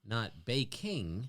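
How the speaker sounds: "baking" drawn out with a clear -ing; clearly said "baking". Shown as a demonstration. Showing it was not bacon, "baking" with a distinct -ing. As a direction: say "baking" slowly, sounding the ending. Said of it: "sounded like bacon".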